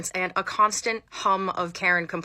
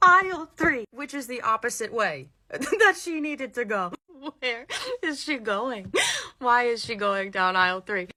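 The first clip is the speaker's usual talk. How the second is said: high, drawn-out words through real laughter; incredulous, breaking into laughs; laughing disbelief; 4 semitones higher than usual. Real, not acted. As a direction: crack up while telling it. Cracks up retelling wrong aisle.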